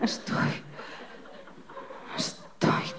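breathy faint whisper, almost gone. Pretend. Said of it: "le dirás bajito". (susurrando débil)

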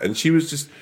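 usual speaking voice

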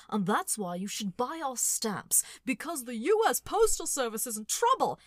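John Oliver impression. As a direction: sell the stamps like a worked-up host. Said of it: "John Oliver impression".

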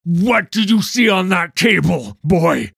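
high, very loud interrogation; angry, bullying shout; furious demand. Acted. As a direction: roar the question at the boy. Interrogator rounds on the boy.

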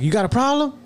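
high pitched mocking "You got a problem?"; mock angry challenge. Old man at the pump picking a fight, hammed-up angry.

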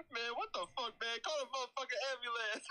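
high, hammed-up imitation of the voice line; lightly voiced Trey voice, quoting the line; imitating Trey's voice. Quoting Trey's voice line to friends, a high mock imitation. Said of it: "Trey doing that voice".

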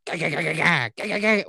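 rapid spoken gah-gah gunfire; machine gun noise. Retold or imitated. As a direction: spit out mouth gunfire bursts.

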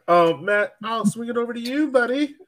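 normal talking voice

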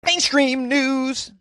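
high, slow mock British squeal; Monty Python accent. Podcaster introducing mainstream news in a Monty Python accent. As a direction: drag it out in a Python granny voice. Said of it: "I don't know why I did that accent".

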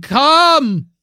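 high beckoning shout; shouting come. Acted. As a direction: call out loud and high.

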